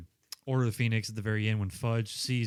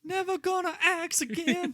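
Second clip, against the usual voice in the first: falsetto joke line; lightly squeaky parody; high mock voice.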